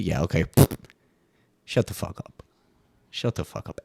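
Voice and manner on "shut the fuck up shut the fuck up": hissed under the breath; whispered shut up. Acted. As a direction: whisper it like a secret insult. Hushed dismissal of the claim.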